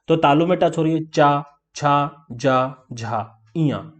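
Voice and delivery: चा, छा, जा, झा, इया with tongue on the palate; touch the palate on चा, छा, जा, झा; palatal demo. Shown as a demonstration. (तालु से चा, छा, जा, झा का उच्चारण)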